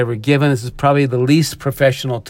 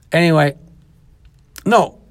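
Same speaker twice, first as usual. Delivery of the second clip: ordinary talk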